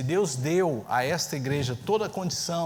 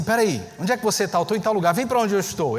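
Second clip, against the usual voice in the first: higher and louder urgent call; closing in on the thief, calling out; excited dad; 5 semitones higher, 6 dB louder than usual. Retold or imitated. Father calling his daughter over as the GPS moves, excited and louder.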